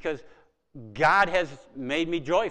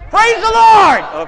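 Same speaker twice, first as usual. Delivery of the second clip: much higher, much louder, slow drawn-out yell; yelled praise; 18 dB louder, much slower than usual. Real, not acted. Congregation jumping up, preacher yelling praise with them. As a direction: shout it to the rafters. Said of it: "I want you to yell".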